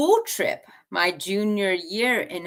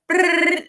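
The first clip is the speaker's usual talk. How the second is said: rapid babble; 11 semitones higher, 6 dB louder than usual. Retold or imitated. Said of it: "you speak too fast".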